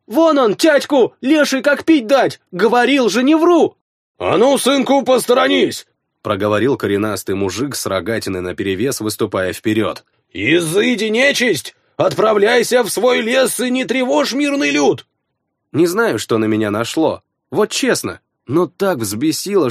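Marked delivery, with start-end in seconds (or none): 0.1-3.8 put-on high, loud panicked child; a scared kid yelling to his father; frightened boy. 4.2-5.9 gruff peasant. Acted. Peasant orders his son aside. 10.3-15.1 exorcising peasant. Acted. Peasant banishes the forest spirit.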